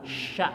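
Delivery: "Sya" spoken soft with the hiss kept inside the mouth; "Sya" with the tongue pulled back. Shown as a demonstration. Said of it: "lidah tarik ke belakang". ("Sya" dengan lidah ditarik ke belakang)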